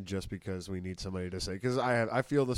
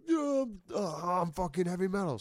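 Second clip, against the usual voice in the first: high mocking whiny voice; mock death metal kid; 10 semitones higher, 4 dB louder than usual. Friend mocking death metal kids, high and whiny.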